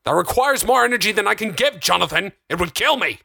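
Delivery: high, exasperated outburst; frantic, high-pitched refusal; heated protest. A narrator's reading. Darren tearing hair, arguing.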